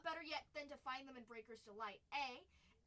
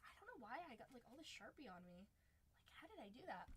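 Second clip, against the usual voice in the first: soft, half-whispered aside; under her breath, puzzled; muttering to herself; 3 semitones lower, 13 dB quieter than usual. Real, not acted. Muttering about Sharpie.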